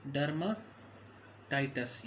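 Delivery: Dermatitis drawn out slowly in two parts; slow dictation. Shown as a demonstration. Teacher dictating a medical term to students, dermatitis said slowly in parts. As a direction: break dermatitis after derma, taking each part slowly.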